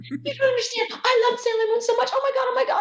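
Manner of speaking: very high squealing fan impression; gushing fangirl. Naked fan declaring love for Sailor Moon, imitated squealing. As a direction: gush breathlessly in a high mock-fangirl voice. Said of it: "She goes".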